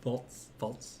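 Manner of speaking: holding back a burp; stifled burp. Real, not acted. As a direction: speak around a held-in burp. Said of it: "trying to hide a burp".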